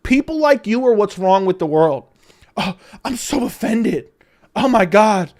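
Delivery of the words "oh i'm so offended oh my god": dripping sarcasm, mock hurt; sarcastic mock offense. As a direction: pretend to be offended.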